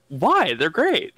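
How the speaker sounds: teasing. Real, not acted. Teasing the player about downtime.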